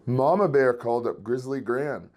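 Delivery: ordinary delivery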